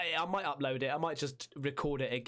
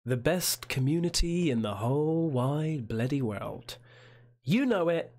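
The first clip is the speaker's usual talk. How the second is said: hyped up; 7 dB louder than usual. Acted. Hyped intro chant.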